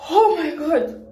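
high, laughing oh my god. Real, not acted. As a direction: burst out laughing in disbelief. Laughs at her own decision.

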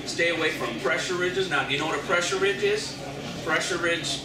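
normal talking voice

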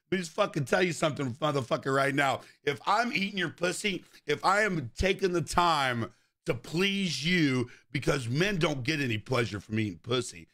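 ordinary delivery

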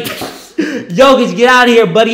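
loud, high heckle through laughter; laughing taunt. Real, not acted. Heckling Jokic on TV, laughing loud taunt. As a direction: laugh while yelling him off.

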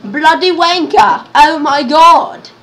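slow, indignant British outburst; hammed-up British cursing; put-on British accent. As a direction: draw it out in a fake British accent. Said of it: "you have to have a British accent".

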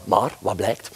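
ordinary talk

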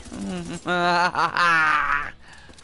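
drawn-out cackle building up; villain-style gloat; gloating laugh. Real, not acted. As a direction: laugh in stages, swelling to an open "ah". Gloating after kills.

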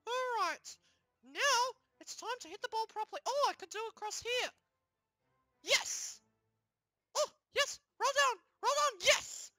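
high-pitched cartoon excitement; high, triumphant little character; gleeful game character. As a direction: cheer your own shot in a squeaky voice.

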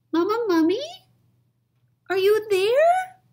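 put-on high little-kid plea; squeaky begging little mummy; pleading child. Baby mummy calling out.

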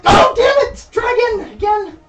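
high frustrated yell; frustrated shout. Real, not acted. Failing game in icy water.

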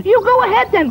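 very high, loud squeaky piggy voices; squeaky piglets. Acted. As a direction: squeal it out like cheeky little pigs. Little pig answers wolf.

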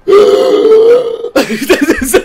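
loud, high drawn-out gasp; zombie-like gasp. Real, not acted. Streamer shocked by the daughter's refusal, zombie-like gasping. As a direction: suck in air like a startled zombie. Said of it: "Start like a zo-, zombie there".

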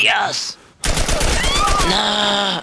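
high-pitched cry of dismay; shocked outcry. Real, not acted. Gamer watching game shooting, high shocked outcry. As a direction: cry out in disbelief.

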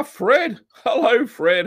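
high, loud delighted hello with a laugh; excited greeting. Real, not acted. Host spots fellow YouTuber Fred in chat, excited high greeting.

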